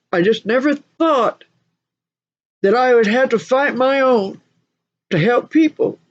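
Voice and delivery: high, lightly tearful retelling; imitating tearful man. Michael fighting his own to help people, tearful. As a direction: voice a man in tears, pitched high. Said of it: "Tears are flowing down".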